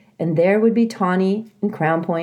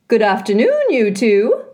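put-on louder, cheery old goose greeting; old goose Loudwing; 5 semitones higher, 5 dB louder than usual. A narrator's reading. Loudwing waddling into the garden, hearty goose voice. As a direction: hail them like a jolly old goose.

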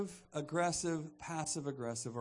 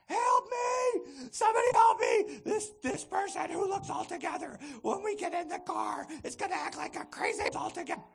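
high, shouted mock panic; hammed-up hysterical cry, pitched way up; frantic plea for help; 13 semitones higher, 7 dB louder than usual. Victim of passive aggressive begging rescue, frantic high shouting. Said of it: "the one on the receiving end".

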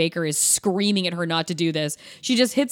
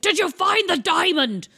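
much higher, eager demand; greedy boss; 9 semitones higher than usual. Retold or imitated. Boss over satellite link, pressing Karen about the diamond, much higher and eager. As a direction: pounce on the question like a greedy boss.

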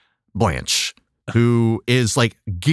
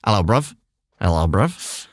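low mock British greeting; British accent; 8 semitones lower than usual. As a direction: play a British lad's hello, pitched down. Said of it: "used with your British accent".

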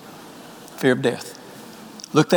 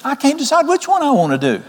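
higher, hesitant musing to himself; thinking aloud; 8 semitones higher than usual. Acted. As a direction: ponder it aloud as a torn man, pitch lifted. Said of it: "He's sitting there thinking".

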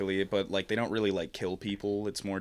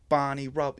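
put-on Cockney accent; 7 semitones higher, 5 dB louder than usual. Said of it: "Cockney British".

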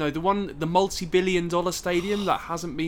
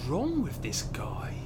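whispered aside; 4 semitones lower, 7 dB quieter, slower than usual. Acted. Aside about the driver.

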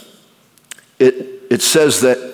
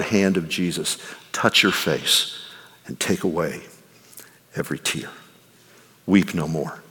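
tender hush; 9 semitones lower, 9 dB quieter than usual. Real, not acted. Preacher tenderly assures grieving listeners, hushed and soft. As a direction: let it sink to a near whisper of comfort.

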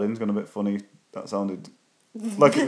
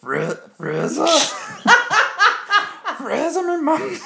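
put-on a much higher, slow, squeaky Geordie girl voice; Spuggy impression; 6 dB louder, slower than usual. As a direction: squeak the name like a kid. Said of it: "a good Spuggy impression".

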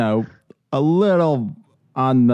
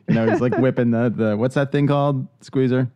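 ordinary delivery

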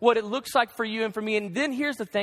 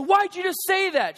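heated question; 8 semitones higher than usual. Retold or imitated. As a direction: push back, feeling wounded. Retelling a marital argument.

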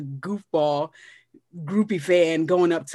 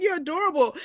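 sweet gushing; 9 semitones higher than usual. Retold or imitated. Celebrity reacting sweetly.

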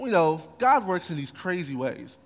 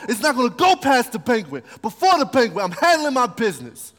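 louder, tough street swagger; tough-talking Haman laying down his threat; rough guy voice; 5 semitones higher, 5 dB louder than usual. Acted.

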